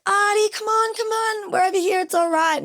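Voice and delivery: very high, held-back distant call; retold calling out.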